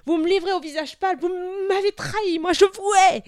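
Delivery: furious, anguished outburst; enraged roar. A narrator's reading. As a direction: roar the accusation, building to a peak. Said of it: "il rugit".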